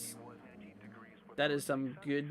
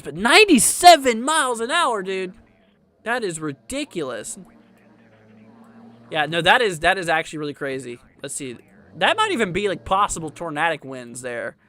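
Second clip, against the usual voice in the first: excited; 9 dB louder than usual. Real, not acted. Excited over record wind gust.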